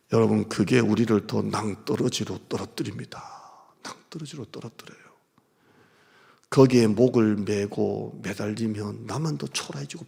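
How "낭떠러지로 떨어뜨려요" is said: whispered echo. Acted. Pastor warning the congregation in a whisper. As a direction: repeat it in a soft whisper.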